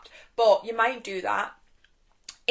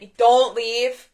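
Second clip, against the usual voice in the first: ordinary talk, nothing marked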